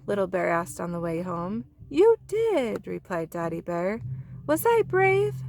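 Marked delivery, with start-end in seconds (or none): 1.9-2.9 proud praise. Acted. Daddy Bear praises. 4.5-5.3 eager little bear. Acted.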